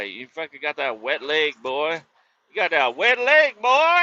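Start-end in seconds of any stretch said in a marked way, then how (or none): 2.5-4.0 much higher, louder mocking shout; hammed-up loud teasing shout; teasing yell. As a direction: holler the taunt so everyone hears. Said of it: "I was like".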